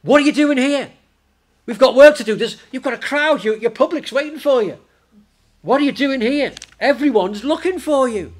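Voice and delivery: high, loud indignant protest; indignant and upset. Acted. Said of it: "being really a bit indignant and upset".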